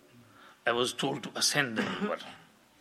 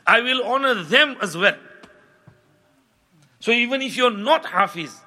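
raised, higher preaching voice; fervent preaching; 7 semitones higher, 7 dB louder, faster than usual. Acted. Preacher urges listeners to love the Quran, fervent. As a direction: drive the lesson home with rising fervour.

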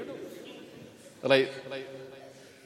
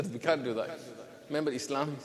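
normal talking voice

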